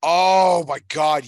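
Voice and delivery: a loud, drawn-out, high-pitched thrill; excited recognition. Real, not acted. Host hears co-host name a film he loves, slow loud delight. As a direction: blurt it out slowly, thrilled he picked it.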